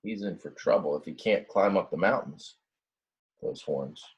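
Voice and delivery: plain reading voice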